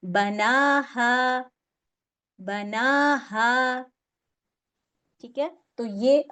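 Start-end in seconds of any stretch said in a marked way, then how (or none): 0.1-3.8 बनाहा drawn out very slowly, vowel held for two counts; two-count stretch. Shown as a demonstration. Teacher demonstrating a two-count madd to students, very slow. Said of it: "उसको कैसे पढ़ेंगे".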